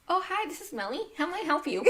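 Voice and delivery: high, soft customer-service greeting; professional phone voice. Acted. Answering a work call after yelling at the dogs, professional phone voice. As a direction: put on your polite work phone voice. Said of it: "your professional hat on".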